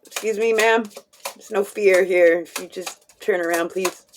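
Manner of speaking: usual speaking voice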